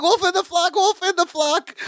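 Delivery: high, mock-alarmed Balky voice, pitched well up; Balky's alarmed cry. Retelling Balky waking in the night, high mock alarm. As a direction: imitate Balky bolting awake, crying out in alarm.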